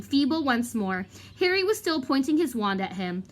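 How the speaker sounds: plain reading voice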